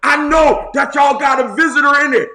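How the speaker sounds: hollering an accusation; confrontational shout. Acted. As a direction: call them out from outside the house. Mob confronts the old man.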